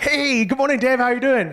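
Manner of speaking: high, chirpy acted hello; acting out a sing-out good morning; cheery mock greeting. Acts his cheery greeting to grumpy Dave.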